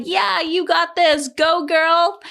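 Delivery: high, slow encouraging cheer; rally the runner from the sidelines; high cheerleader encouragement; cheering crowd. Retold or imitated. Spectators cheering her on.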